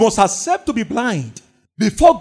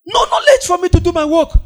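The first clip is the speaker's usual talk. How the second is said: high, ringing preacher's shout; preaching shout; 8 semitones higher than usual. Acted. Preacher shouting to the congregation about lacking knowledge, full-voiced. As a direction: cry it out to the whole church.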